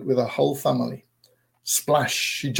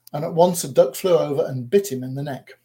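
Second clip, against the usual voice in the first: plain reading voice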